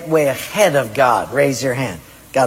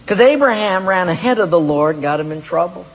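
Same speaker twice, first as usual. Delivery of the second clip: ordinary talk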